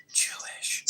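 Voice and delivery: slow conspiratorial whisper; hushed, slow, sneering aside. Acted. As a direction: drop to a sly whisper for the jab. Insulting aside mid-rant.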